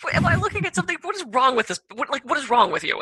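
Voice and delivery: can't believe what she just asked; incredulous outrage. Retold or imitated. Outraged at her question.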